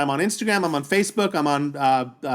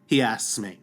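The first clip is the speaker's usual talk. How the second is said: ordinary delivery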